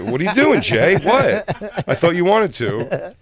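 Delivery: high, laughing disbelief; surprised. Retold or imitated. Acted surprised reply.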